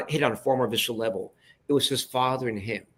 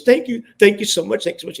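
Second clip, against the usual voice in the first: high, loud tearful thanks, hammed up; imitated bawling gratitude; 9 semitones higher, 8 dB louder than usual. Boss thanking the director after the spot, mock bawling. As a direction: imitate a man overcome, voice leaping high. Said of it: "he's bawling hysterically".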